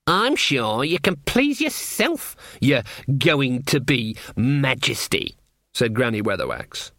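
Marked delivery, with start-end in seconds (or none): 0.1-5.4 put-on sarcastic old witch. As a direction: needle them with drawn-out false politeness. Voices Granny, sarcastic.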